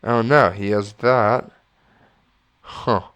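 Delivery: ordinary talk, nothing marked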